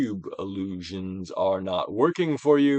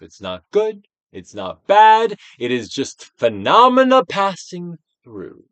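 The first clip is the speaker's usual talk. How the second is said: emphatic mantra; 7 semitones higher, 9 dB louder than usual. Shown as a demonstration. Modelling phrase to say.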